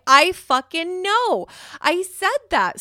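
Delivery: exasperated outburst. Retold or imitated. To her husband after he finally sees it, exasperated. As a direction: hammer it home, fed up at being doubted. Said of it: "And I'm like".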